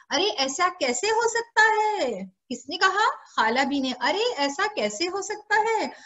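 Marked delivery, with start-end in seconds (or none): 0.1-2.2 high, drawn-out incredulous question; shocked disbelief. Acted. Aunt refusing to believe the boy is stealing, shocked disbelief. 3.9-5.9 high-pitched disbelieving question; lightly acted astonishment, voice lifted; incredulous protest. Aunt hearing the boy is accused, incredulous protest. Aunt's disbelief, repeated by teller.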